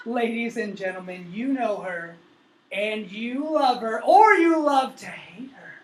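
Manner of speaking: rising from eager to a loud climax on "or you love to hate her"; building announcer intro. Acted. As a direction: hype the crowd, bigger each line.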